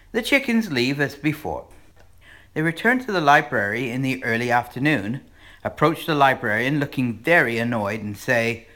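ordinary talk, nothing marked

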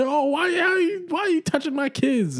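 shocked parent. Acted. Intruder scolding the real parent, squealed falsetto shock. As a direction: squeal it like an outraged homeowner. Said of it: "You're like".

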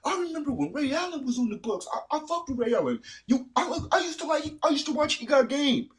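high, squeaky mock fan name-dropping; bandwagon fan voice. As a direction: parody a poser fan. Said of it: "naming out throwback players, name dropping".

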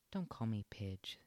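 ordinary delivery, nothing marked

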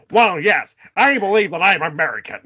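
loud, over-enunciated mock American; exaggerated American accent. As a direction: overdo every American vowel. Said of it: "does an American accent".

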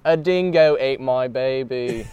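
drawn-out Aussie caricature; hammed-up slow Australian accent; mock Australian accent. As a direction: slowly stretch out each Australian vowel. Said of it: "he's turning Australian".

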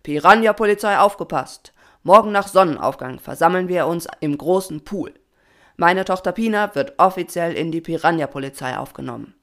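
loud official announcement; proclaiming. A narrator's reading. Papa announces his daughter's admission to the swarm, proclaiming loudly. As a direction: address the whole force with authority. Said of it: "verkündigte Pinas Papa".

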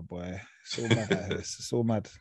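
ordinary talk, nothing marked

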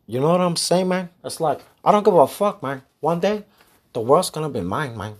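a hint of a cocky hustler voice; put-on voice. Podcaster trying out a character voice, mild swagger. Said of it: "I kinda fucked up on this voice".

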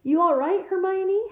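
usual speaking voice

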